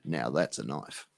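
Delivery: a deep slow Dundee boast; Crocodile Dundee impression. Showing off his knife to chat, deep and slow. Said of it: "Paul Hogan".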